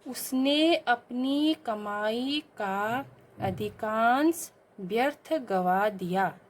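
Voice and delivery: very slow reading of the wrong sentence; slow dictation. Shown as a demonstration. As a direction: read each word slowly for pupils. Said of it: "अगला है बच्चों". (धीमा पाठ)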